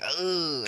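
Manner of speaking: loud, high groan of frustration; frustrated groan. Real, not acted. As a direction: groan out loud at your blunder. Frustrated at own mistake in game.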